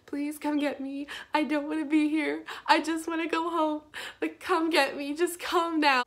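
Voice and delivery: high, teary pleading to come get her; crying on the phone. Retold or imitated. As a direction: beg through tears for a ride home.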